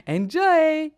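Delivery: high, loud, drawn-out call; excited send-off. Real, not acted. Host sending listeners into the show, excited call. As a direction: sing it out brightly to listeners. Host's sing-out before the break.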